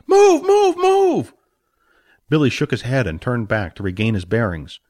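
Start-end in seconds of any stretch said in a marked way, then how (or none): 0.1-1.3 loud high barked commands; shouting orders. Acted. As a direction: drive him forward, shouting. Jack urges through crowd.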